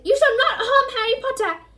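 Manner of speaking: high, loud elf shout; Dobby yelling to protect Harry. Acted. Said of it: "But Dobby shouted".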